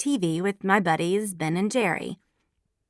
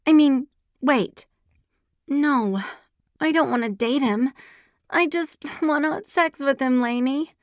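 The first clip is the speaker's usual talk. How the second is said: whining; 7 semitones higher, 3 dB louder than usual. A narrator's reading. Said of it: "I may have whined".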